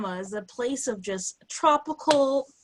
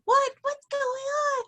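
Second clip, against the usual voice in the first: mock confused kid voice; slower than usual. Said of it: "I was like".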